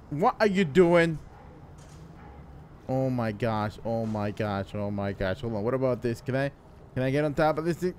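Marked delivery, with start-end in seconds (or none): 2.9-7.0 low, soft repeated fretting; fretful muttering. Acted. Stuck in the water, fretting to himself, muttering. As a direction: mumble it to yourself, low.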